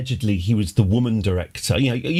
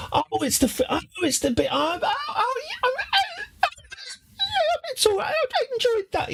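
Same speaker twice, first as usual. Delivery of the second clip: much higher, laughing squeals of delight; mock delighted viewer; 4 dB quieter than usual. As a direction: ham up a fan spotting the good bit, squeaky and giggly. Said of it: "you'll probably go".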